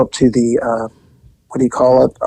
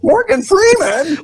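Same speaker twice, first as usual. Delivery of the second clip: high, slow incredulous question; can't believe what you just heard; high drawn-out shocked rising question; stunned disbelief. Real, not acted. Disbelief after the catcall.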